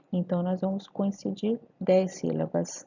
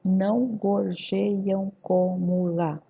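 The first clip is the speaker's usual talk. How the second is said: "Não gorjeiam como lá" slow, syllable by syllable; syllable division; 3 dB louder, much slower than usual. Shown as a demonstration. Teacher dividing grammatical syllables, slow and separated. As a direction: measure out every syllable evenly. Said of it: "Sete sílabas gramaticais".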